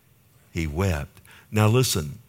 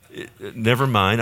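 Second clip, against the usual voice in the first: ordinary talk, nothing marked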